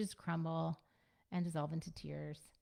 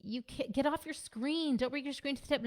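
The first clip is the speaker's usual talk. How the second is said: raised, higher nagging parent voice; screen scolding; 7 semitones higher than usual. Retold or imitated. Parent at the dinner table scolding a kid, nagging and raised. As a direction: snap at the kid to drop the screen.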